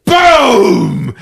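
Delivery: long drawn-out, booming explosion noise; big imitated explosion; explosive boom. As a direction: boom it out like a blast.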